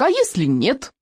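high, worried little question; squeaky. A narrator's reading. Friend doubts the volcano plan, squeaking. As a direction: squeak it with the pitch jumping. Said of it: "пискнул Рыбьенок".

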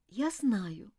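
soft, subdued admission; quiet. Acted. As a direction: admit it quietly. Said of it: "тихо сказала Элизабет".

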